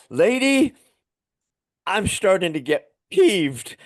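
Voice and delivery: high, loud mock-exasperated outburst at the hotel clerk; exasperated Tony Robbins. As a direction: squeal it out like a tired man at the end of his rope. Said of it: "he goes".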